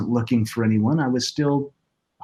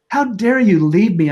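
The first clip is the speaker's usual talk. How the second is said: higher and louder, crying out at her; retold angry outburst; 7 semitones higher, 5 dB louder than usual. Widower shouting at his late wife's grave, raw angry outcry. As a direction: let the anger burst through. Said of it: "and I was angry".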